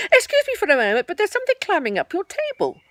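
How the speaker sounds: high, loud bouncy tiger voice; big bouncy tiger; Tigger voice. A narrator's reading. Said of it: "Tigger said".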